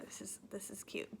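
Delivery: soft teary whisper; tearful whisper to herself. Real, not acted. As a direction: breathe it out to yourself. Tearful aside while crying.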